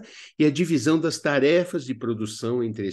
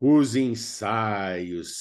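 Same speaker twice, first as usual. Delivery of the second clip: stretched teasing repeat; 3 semitones lower, much slower than usual. Acted. Repeats topic, then laughs.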